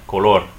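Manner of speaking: color with a loud final -LOR; last-syllable stress. Shown as a demonstration. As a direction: punch the end of co-LOR. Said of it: "Stress the last syllable".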